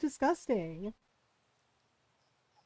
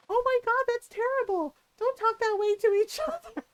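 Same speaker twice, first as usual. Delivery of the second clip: much higher, shocked scolding voice; scandalized friend; 9 semitones higher than usual. Retold or imitated. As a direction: play the appalled prim friend clutching her pearls. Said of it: "looking horrified".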